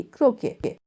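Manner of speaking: क्रोके said as kro-kay, silent final t; croquet said right. Shown as a demonstration. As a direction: give क्रोके with an open kay ending. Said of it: "सही से कहते हो क्रोके".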